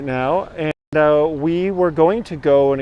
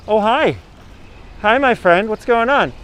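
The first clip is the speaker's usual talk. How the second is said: talking to an animal; 8 semitones higher than usual. Real, not acted. Greeting a sea lion.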